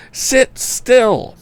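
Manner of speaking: high panted order; panting. A narrator's reading. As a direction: pant it out of breath. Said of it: "panted our hero".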